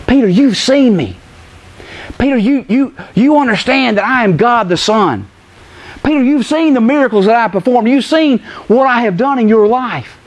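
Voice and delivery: lightly voiced Jesus. Preacher as the Lord asking Peter about doubt, pleading. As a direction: press the doubter with mild reproach. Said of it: "what did he ask him?".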